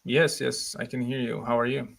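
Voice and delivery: ordinary talk, nothing marked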